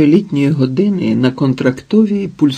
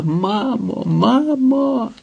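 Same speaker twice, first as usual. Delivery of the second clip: high drawn-out wail, climbing higher on the repeat; sobbing cry, understated; 9 semitones higher, 4 dB quieter, much slower than usual. A narrator's reading. Daughter runs sobbing to her mother, understated sobbing cry. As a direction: cry out for mother in a held-back, rising wail. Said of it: "ридала, кричала".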